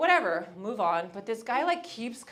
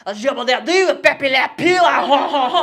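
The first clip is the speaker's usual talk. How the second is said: loud, higher fake French accent with laugh; mock French gibberish; 4 semitones higher, 14 dB louder than usual. DJ on the mic, loud mock French accent. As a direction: ham up Pepe Le Pew French. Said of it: "He gets on the mic".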